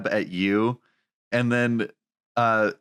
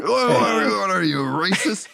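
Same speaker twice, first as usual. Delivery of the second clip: mocking bus guy; 7 semitones higher than usual. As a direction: jeer like a rowdy stranger. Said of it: "this bald guy's like".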